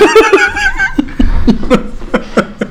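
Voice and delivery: long, very high laugh; high-pitched laughing fit. Real, not acted. Co-host cracks up at the stumped guest, high laughing fit.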